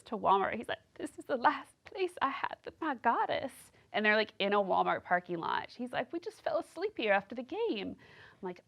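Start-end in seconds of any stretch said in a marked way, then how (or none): 1.0-3.5 high dazed guy voice, lightly put on; a spaced-out boyfriend, higher; dopey man voice. Imitating man in Walmart. 6.1-8.0 high dazed guy voice, lightly put on; a spaced-out boyfriend, higher; dopey man voice. Imitating man's excuse.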